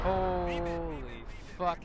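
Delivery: shocked. Real, not acted. Sudden shock in firefight.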